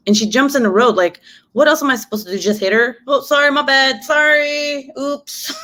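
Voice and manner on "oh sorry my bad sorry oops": high, sing-song fake sorry; breezy, insincere oops; mock apology. Mocking a driver who just hits the jogger, high fake apology.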